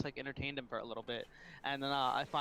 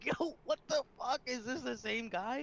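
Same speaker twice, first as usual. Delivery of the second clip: shocked disbelief; 10 semitones higher than usual. Retold or imitated. Retelling a baffling phone call.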